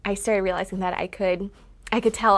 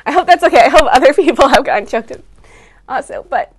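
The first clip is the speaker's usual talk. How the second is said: higher, tearful voice fighting to keep talking; choked up; 6 semitones higher, 6 dB louder than usual. Real, not acted. Daughter recalling her mother's sacrifice on stage, choked up. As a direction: push through real tears, with breaks between phrases. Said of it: "I'm getting a little choked up".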